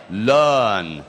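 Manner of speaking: "learn" with a long groaned uh and no R; suono del moribondo. Shown as a demonstration. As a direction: stretch the vowel of learn like a sick man's moan. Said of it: "tipo l'uomo che sta morendo". (vocale lunga e gemuta, senza R)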